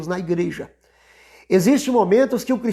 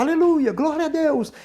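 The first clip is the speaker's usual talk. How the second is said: high, drawn-up mock hallelujah cry; imitated praise shouting; 7 semitones higher than usual. Another worshipper joining the church uproar, imitated shouting. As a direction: imitate a congregant crying out praise. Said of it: "E outro já gritava".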